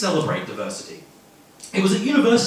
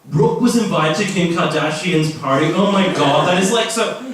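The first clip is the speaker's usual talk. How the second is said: gushing gossip; 4 semitones higher, 9 dB louder than usual. Acted. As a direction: gush like a celebrity-obsessed student. Ditzy gossip misunderstands.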